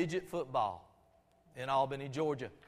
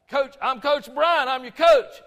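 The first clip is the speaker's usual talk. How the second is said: coach shouting; 7 semitones higher, 13 dB louder than usual. Retold or imitated. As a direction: boom it out so the kid hears. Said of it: "Coach Bryant yells".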